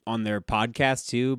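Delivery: normal talking voice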